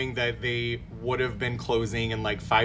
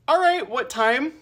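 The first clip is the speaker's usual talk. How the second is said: stunned meek reply; 13 semitones higher, 4 dB louder than usual. Retold or imitated. Frozen reply to rude pharmacist.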